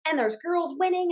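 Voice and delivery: normal talking voice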